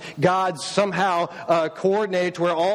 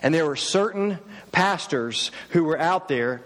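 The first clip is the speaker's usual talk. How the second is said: normal talking voice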